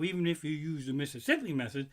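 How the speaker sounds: ordinary delivery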